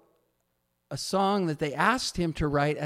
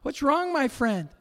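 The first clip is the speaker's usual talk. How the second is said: high, anxious friend asking again and again; a friend rushing in alarmed, pitched way up; worried friend; 11 semitones higher, 3 dB louder than usual. Retold or imitated. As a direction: friend bursting in on the sobbing composer, high and worried. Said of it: "he just goes".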